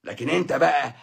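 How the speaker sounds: ordinary delivery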